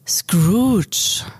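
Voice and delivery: eerie spirit calling his name; Marley's ghostly voice. A narrator's reading. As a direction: call out like a ghost from beyond. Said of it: "sprach Marley mit einer geisterhaften Stimme".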